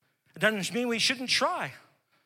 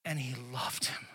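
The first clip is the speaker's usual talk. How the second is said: whispered, soft and tender; hushed loving whisper; 7 semitones lower, 9 dB quieter than usual. Acted. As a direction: breathe it gently. Tender revelation.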